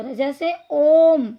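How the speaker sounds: a slow, drawn-out ओम. Shown as a demonstration. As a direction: stretch ओम into a long held ओम्म्म. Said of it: "ओम बोलने में इनका प्रयोग".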